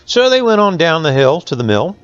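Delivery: ordinary delivery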